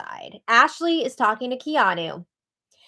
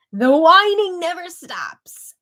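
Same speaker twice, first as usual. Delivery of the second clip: loud, slow, fed-up remark; fed-up slow complaint; exasperated; 7 semitones higher, 6 dB louder, slower than usual. Real, not acted. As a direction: let the annoyance out. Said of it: "exasperated comment".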